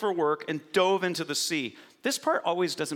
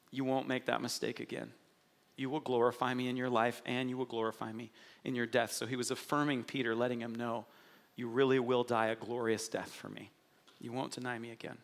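much softer, gentle reassurance; tender, hushed; 5 semitones lower, 6 dB quieter than usual. Acted. Jesus reassuring Peter after his failure, soft and tender. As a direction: speak it like a quiet promise to a friend.

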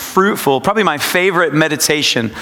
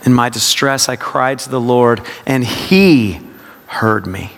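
HE punched up, then a pause; low meditative reading, weight on HE; stress on HE; 5 semitones lower than usual. Shown as a demonstration. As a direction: hit "he" and let it hang, then drop low. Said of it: "it's a practice of word emphasis".

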